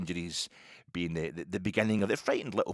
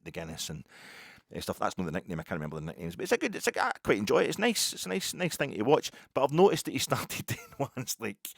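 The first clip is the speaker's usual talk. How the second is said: ordinary talk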